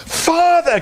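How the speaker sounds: high joyful gasp. A narrator's reading. Children reunited with father, high and joyful. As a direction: cry out in relief. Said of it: "gasped the children".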